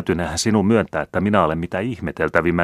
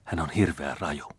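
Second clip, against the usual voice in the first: hushed, softer aside; whispered warning; 6 dB quieter than usual. A narrator's reading. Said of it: "kuiskasi sisar".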